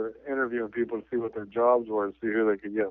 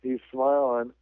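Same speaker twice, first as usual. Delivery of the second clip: normal talking voice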